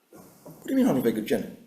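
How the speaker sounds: rapid, rushed comeback; affronted retort. Acted. Alexander answering the insult from Clark, affronted and rapid. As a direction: fire the question straight back, very fast. General acted answering the accusation.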